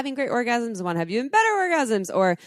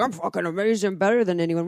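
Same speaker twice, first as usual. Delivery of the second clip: mock bragging. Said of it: "brag about it and be like".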